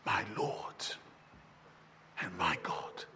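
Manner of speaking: a hushed, reverent whisper of awe; whispered confession of faith; awed whisper. Acted. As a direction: breathe it, barely voiced and low.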